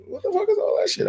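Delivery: very high, fast, put-on old man's outrage; puzzled grandpa. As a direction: squeal it like a baffled granddad. Grandfather eyeing the junk food.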